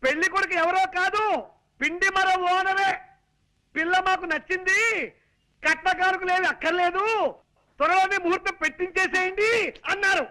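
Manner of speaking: high-pitched, sustained announcing voice; shouting to be heard. Acted. Son-in-law giving deaf aunt wedding news, high shouting. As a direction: belt out the news phrase by phrase for deaf ears. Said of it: "మామూలుగా చెప్పొచ్చు కదా".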